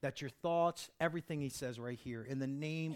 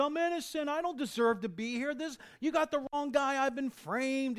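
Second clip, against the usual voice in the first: much higher, louder mock protest of innocence; preacher imitating a jailed man pleading innocence, higher and louder; protesting prisoner; 10 semitones higher, 6 dB louder than usual. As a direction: play the indignant inmate.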